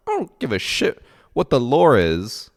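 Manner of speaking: loud, high exasperated outburst; angry yell. Retold or imitated. To the alt girl about album lore, loud exasperated yell. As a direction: yell it back at her, fed up.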